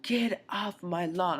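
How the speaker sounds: hammed-up cranky old woman voice, drawn out; retold old lady yell. Old lady on her doorstep ordering him off, mock yell. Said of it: "yells at me so loud".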